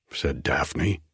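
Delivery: usual speaking voice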